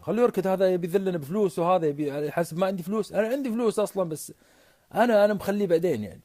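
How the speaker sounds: high-pitched, dismissive retort; dismissive commander. Acted. Acted commander brushing off the claimant. (باستخفاف وبنبرة عالية)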